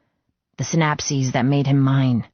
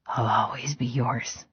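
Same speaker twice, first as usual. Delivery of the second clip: whispered promise; 3 semitones lower, 7 dB quieter than usual. Acted. Hurt man holding his love close, whispering softly. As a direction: breathe the promise into her ear.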